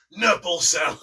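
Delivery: sudden high, very loud yell; shouting Krieg the Psycho. Acted. Contestant acting a psycho's garbled thank you, sudden loud shout. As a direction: blurt it out as a psycho's scream. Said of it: "he just goes".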